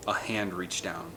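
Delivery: plain reading voice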